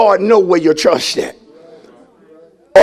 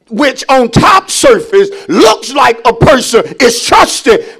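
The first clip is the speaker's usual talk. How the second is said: usual speaking voice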